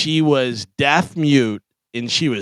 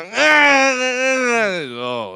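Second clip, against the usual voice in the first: mock wordless yell; 9 semitones higher, much slower than usual. Imitating deaf girl yelling again.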